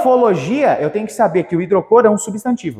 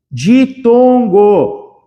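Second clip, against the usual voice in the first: very slow, stretched syllables; drawn-out term; much slower than usual. Shown as a demonstration. Teacher naming the term for students, very slow and drawn out. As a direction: drag out each syllable for the class.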